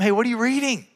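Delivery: light, friendly question to a stranger; friendly opener. Shown as a demonstration. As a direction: casually ask a stranger in a coffee shop. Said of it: "you just ask them".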